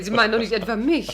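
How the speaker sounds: ordinary talk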